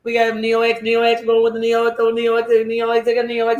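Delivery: rapid monotone auctioneer chant. Acted. Streamer auctioning Neo X, auctioneer chant. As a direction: rattle bids off on one pitch. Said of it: "that's the auction".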